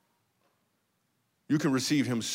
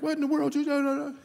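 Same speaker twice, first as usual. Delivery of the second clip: high-pitched mock-nagging wife. Husband mocking how his wife would react, mock high-pitched nagging. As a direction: squeal it in a far higher put-on voice. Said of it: "my wife don't even talk like that".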